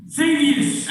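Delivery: high call from across the crowd. Acted. [name] spots Thaddeus across the crowd, calling out. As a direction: call his name from far back. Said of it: "you can hear [name] in the back go".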